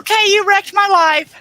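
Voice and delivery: husband jokingly voicing his wife's complaint to the chat in a high mock falsetto. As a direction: squeak it out like a wronged wife, playing it for laughs.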